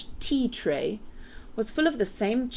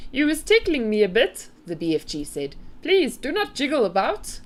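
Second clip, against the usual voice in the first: high, louder giant's complaint; storybook giant, bothered by tickling; ticklish giant; 6 semitones higher, 4 dB louder than usual. A narrator's reading. BFG asking Sophie to keep still, high ticklish voice.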